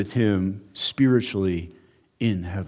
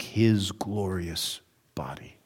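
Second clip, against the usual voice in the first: whisper; 3 semitones lower, 7 dB quieter, slower than usual. Real, not acted. Preacher drops voice for emphasis.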